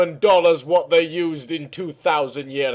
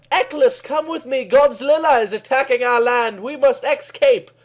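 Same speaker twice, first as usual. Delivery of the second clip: urgent heroine; 8 semitones higher than usual. Acted. Kelly urges deer to flee.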